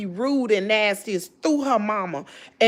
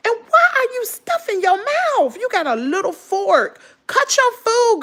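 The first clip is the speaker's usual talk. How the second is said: scolding lecture; 7 semitones higher than usual. Retold or imitated. To a girl seen eating in a video, scolding in a raised high voice. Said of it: "I was like".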